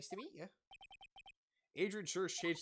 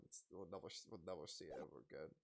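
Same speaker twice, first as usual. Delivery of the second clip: ordinary talk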